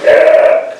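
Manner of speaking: loud, comic strangled "eh"; mock frozen-corpse croak; pickled dodo noise. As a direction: imitate a dead bird stuck in a jar. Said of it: "a dodo in a glass jar of liquid going".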